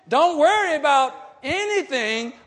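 "Don't worry about anything" thrown out loud with a push on worry; rising pulpit shout. Acted. Louder repeat of verse.

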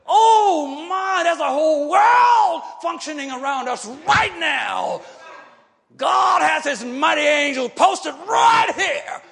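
loud, rising preacher's cry; full-voiced revival preaching; preaching at full force. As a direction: lift the church with a shouted proclamation. Preacher building to a shout.

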